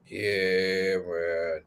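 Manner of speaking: low, slow, laid-back impression; Dutch. Host's bad impression of a colleague, low and slow. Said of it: "Last one, Dutch".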